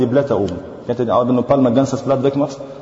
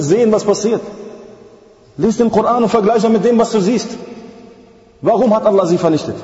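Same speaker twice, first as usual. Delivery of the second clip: raised, higher-pitched sermon delivery; impassioned preaching; 9 semitones higher than usual. Real, not acted. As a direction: fire rhetorical questions at the congregation.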